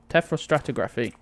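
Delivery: usual speaking voice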